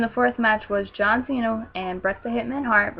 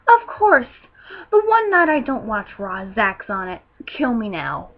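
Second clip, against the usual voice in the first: exasperated outburst; 6 dB louder than usual. Retold or imitated. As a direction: cry out in mock despair at your luck. Acting out own exasperated reaction.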